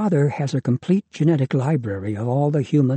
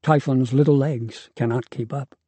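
ordinary delivery

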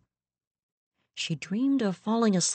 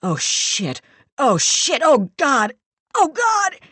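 building panicked scream, higher and louder; screamed in terror; 9 semitones higher, 7 dB louder, slower than usual. Acted. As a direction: terror climbing into a shriek. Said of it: "He screamed".

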